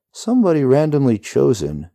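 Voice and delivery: loud, high call over the wind; shouting over wind. A narrator's reading. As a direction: project over the rushing wind. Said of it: "the rider shouts".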